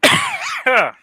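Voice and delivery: choking, coughing mid-word. Real, not acted. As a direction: cough through the words, choking. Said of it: "don't know why I'm choking".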